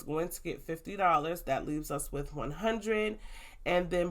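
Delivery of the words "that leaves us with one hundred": usual speaking voice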